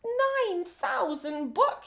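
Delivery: put-on girl aghast at the reading challenge, shrieking. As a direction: shriek the number in disbelief. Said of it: "shrieks Maria".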